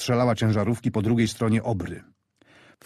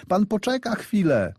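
much higher woman's voice calling to the driver; frantic mother begging the train to wait; lady in a hat shouting to the engine driver to wait; 11 semitones higher than usual. Acted. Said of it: "krzyczała do maszynisty kobieta w kapeluszu".

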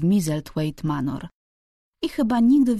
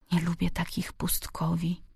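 whispered thought to herself; inner voice; 6 dB quieter than usual. A narrator's reading. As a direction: whisper it privately, barely voiced. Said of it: "powiedziała sobie w duchu".

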